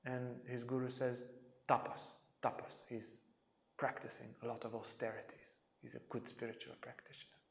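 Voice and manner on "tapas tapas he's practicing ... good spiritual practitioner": breathy, whispered answer; hushed reply. Retold or imitated. Guru whispering to Polly about austerities. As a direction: let the secret out in a whisper.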